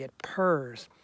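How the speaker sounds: ordinary delivery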